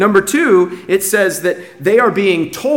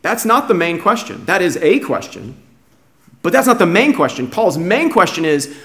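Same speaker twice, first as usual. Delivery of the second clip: ordinary delivery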